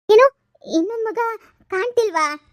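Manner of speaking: high, loud shocked outburst; husband startled that the child is missing. Acted. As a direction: react in sudden alarm.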